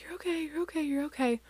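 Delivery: high, soft repeated soothing; calming down. Retold or imitated. Mom trying to calm daughter, soft high reassurance. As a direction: soothe a crying child in a small high voice. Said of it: "calm down".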